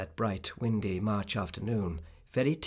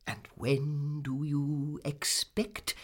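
very slow, drawn-out question; measured, prying aunt; inquiring Miss Betsy; 3 semitones higher, much slower than usual. Acted. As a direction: ask it deliberately, stretching the words.

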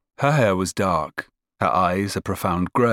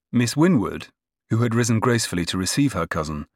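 ordinary delivery, nothing marked

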